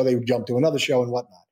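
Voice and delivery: ordinary talk, nothing marked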